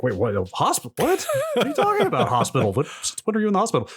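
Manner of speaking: rising, incredulous rapid questions; mock disbelief. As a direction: act stunned by the news. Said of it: "And I was like".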